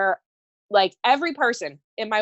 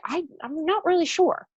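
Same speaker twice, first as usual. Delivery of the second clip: higher, hesitant, doubtful little voice; unsure inner voice; 9 semitones higher than usual. Retold or imitated. The doubting half of herself, higher and hesitant. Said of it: "the other half of you might be like".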